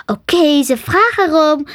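mild growl of a provoked dragon; growled threat. A narrator's reading. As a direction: had enough, ready to fight. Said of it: "gromde Sam".

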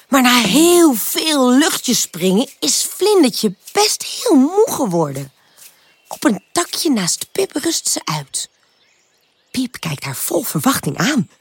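usual speaking voice

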